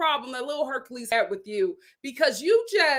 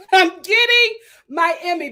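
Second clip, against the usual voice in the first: loud, higher, emphatic repeat; producers' glee; 4 semitones higher, 8 dB louder than usual. Retold or imitated. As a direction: imitate producers crowing out loud. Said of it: "the producers were just like".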